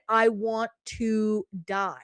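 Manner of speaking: ordinary talk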